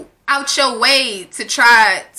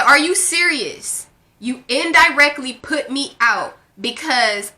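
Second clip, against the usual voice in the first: careful reading. Retold or imitated. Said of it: "I gotta read the, this, this, these parts of the text messages carefully".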